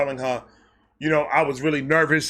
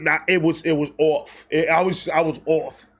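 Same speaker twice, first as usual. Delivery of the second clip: usual speaking voice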